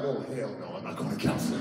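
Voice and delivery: low, muted stubborn refusal; comic low grumble; grumbled refusal. Retold or imitated. Refusing counseling in retold quarrel.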